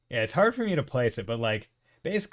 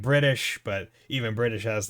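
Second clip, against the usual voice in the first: usual speaking voice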